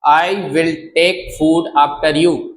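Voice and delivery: very slow, every word laid out for learners; slow, deliberate classroom model; slow teaching demo. Shown as a demonstration. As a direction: spell it out slowly like a teacher. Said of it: "तो आप बोल देना".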